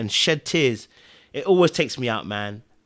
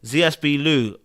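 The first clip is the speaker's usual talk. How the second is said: ordinary talk, nothing marked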